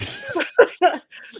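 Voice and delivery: high, loud whooping laugh; shouted laugh. Real, not acted. She whoops with loud, high laughter after her burn. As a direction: let out a big loud laugh.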